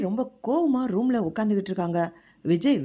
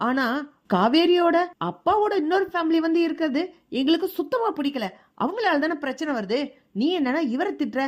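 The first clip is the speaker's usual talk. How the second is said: higher pitched scolding aunt, a touch louder at the start; aunt angrily tells off her nephew, pitched up; angry scolding; 6 semitones higher, 3 dB louder than usual. Acted. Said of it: "Radha சித்தி வேற கோவப்படுறாங்க".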